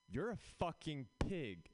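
hammed-up offended jogger; disgusted woman. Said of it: "she was like".

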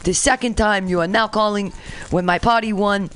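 hammed-up foreign president in a fake Russian accent; bad Russian accent. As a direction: read formally in a badly faked Russian accent. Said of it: "how do I sound like a Russian".

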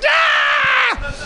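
very high shouted cheer; screeching cheer. Retold or imitated. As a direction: shriek it like the section captain. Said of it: "she's like".

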